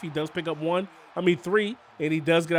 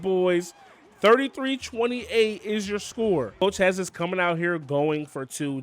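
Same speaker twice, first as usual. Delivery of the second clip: ordinary talk, nothing marked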